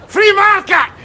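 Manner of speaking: ranting shout of free market; shouted slogan. Acted. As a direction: bellow it like a street ranter.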